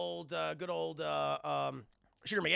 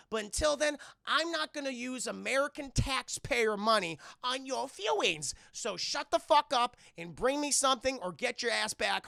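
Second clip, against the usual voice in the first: raised, louder mock-general rant; angry dressing-down; 6 semitones higher, 5 dB louder than usual. General in Washington rebuking agent over feelings, angry. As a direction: chew him out down the phone.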